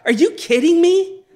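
Moses can't believe his ears; outraged disbelief. Acted. Moses reacts to Aaron's excuse.

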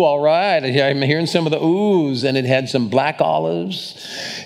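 ordinary talk, nothing marked

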